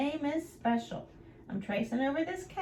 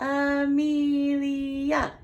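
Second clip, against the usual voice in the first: slow, syllable by syllable; counting syllables; 5 dB louder, much slower than usual. Shown as a demonstration. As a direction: split Amelia into its four syllables, slowly. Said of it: "How many syllables?".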